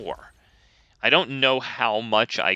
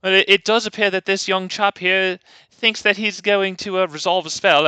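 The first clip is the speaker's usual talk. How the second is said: put-on smug posh British accent, pitched up; 7 semitones higher than usual. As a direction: sneer in a British accent at the young chap. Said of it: "kind of a smug British".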